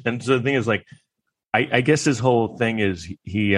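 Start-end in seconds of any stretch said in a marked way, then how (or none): none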